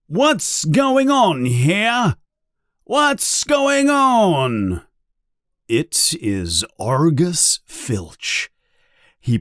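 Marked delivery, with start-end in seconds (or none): 0.1-4.8 high, drawn-out angry demand; loud voice. A narrator's reading. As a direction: call out loudly, slow and high-pitched. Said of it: "there is a loud voice".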